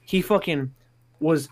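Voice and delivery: normal talking voice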